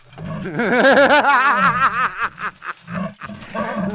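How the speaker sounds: loud, high villain's cackle; a long, mocking villain laugh; evil laugh. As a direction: laugh in gloating triumph. Villain gloats over captive.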